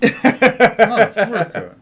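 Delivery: high, slow laughing shout; laughing curse. Real, not acted. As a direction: crack up and drag it out. Laughing outburst at joke.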